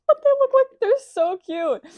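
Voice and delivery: very high, loud cooing delight; gushing squeal. Real, not acted. Vlogger showing off her friend's little guys, squealing. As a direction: squeal over how adorable they are.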